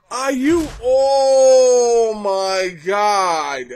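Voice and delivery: loud, high, drawn-out shout; shocked outburst. Real, not acted. Viewer sees all the blood, long horrified shout. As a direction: yell it in horror, stretching the words out.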